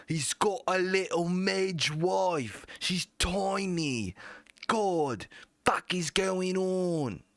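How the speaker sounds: high, slow put-on voice; mock rapper voice. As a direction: imitate the rapper mockingly. Mocking imitation of the rapper.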